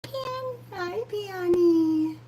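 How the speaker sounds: high, slow cooing at a cat; pet talk. Real, not acted. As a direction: coo up high to the kitty.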